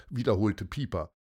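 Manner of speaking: plain reading voice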